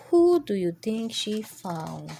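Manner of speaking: plain reading voice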